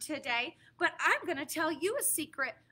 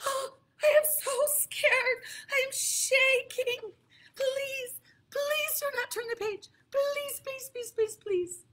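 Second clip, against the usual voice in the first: high, trembling begging; high shaky please, please; frightened pleading; 7 semitones higher than usual. Acted. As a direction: beg desperately. Grover begs before last page.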